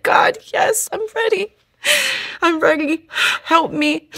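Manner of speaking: very high, breathless sobbing voice; sobbing surrender. Retold or imitated. Surrendering to God in the jail cell, sobbing high. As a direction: cry it out, voice cracking high. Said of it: "I begin to just cry even more".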